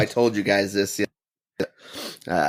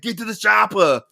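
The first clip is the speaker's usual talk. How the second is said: Arnold line; 12 semitones higher than usual. Retold or imitated. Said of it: "I should have said".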